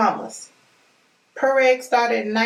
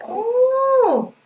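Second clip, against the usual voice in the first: much higher, louder long ooh of delight; 14 semitones higher, 4 dB louder than usual. Real, not acted. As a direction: swoon over the soap. Smelling the natural soap.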